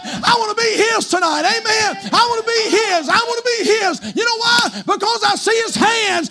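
high, loud shouted preaching; full-force preaching. Acted. Preacher shouting to the congregation, full force. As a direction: build to a roaring climax.

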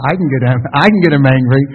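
loud, gleeful boast in mimicked Tennessee accent; Nikki, gleeful confidence. Wife boasting she can rile her husband, imitated gleeful confidence. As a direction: boast with relish, punching it out loudly. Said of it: "she had such confidence and such almost glee".

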